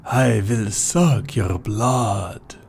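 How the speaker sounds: slow, lightly hammed vampire voice; the Count. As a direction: drag it out like a campy vampire. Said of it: "Is it, is it like the Count?".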